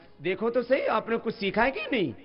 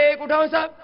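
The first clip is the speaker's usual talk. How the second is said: loud, raised-pitch exercise count; 5 semitones higher, 7 dB louder, slower than usual. Real, not acted. Teacher leading leg raises for everyone, counting out loud. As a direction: call the count to the whole class.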